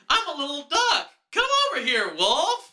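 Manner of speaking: high cartoon duck voice, way up; sing-song little duck calling the wolf; squeaky duck voice. Fake ad jingle duck voice.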